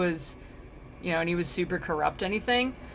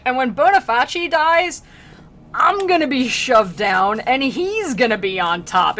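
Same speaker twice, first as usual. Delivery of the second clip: loud, comic complaint; hammed-up loud griping; mock indignant ranting; 6 semitones higher, 10 dB louder than usual. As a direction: protest your fate at the top of your voice. Pope's acted paraphrase.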